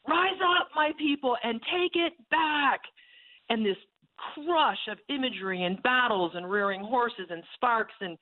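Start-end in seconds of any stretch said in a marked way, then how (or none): 0.1-2.9 much higher, slow rallying call; commanding cry. Retold or imitated. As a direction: lift them up with a high call to rise. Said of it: "the voice of my God shouting".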